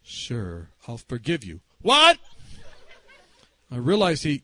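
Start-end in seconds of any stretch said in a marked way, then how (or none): 0.0-1.6 low, soft and patient; discreet repeat. Retold or imitated. Low reply before shouting. 1.8-2.2 high, loud "what?"; hard of hearing. Retold or imitated. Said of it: "forgot his hearing aids".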